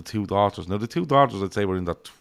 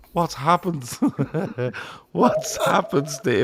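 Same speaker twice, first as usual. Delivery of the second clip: higher, puzzled host voice; mock studio host; 7 semitones higher, much slower than usual. Studio host asking McLaren what happened, mock alarm. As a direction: ask in alarmed disbelief. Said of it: "And he goes".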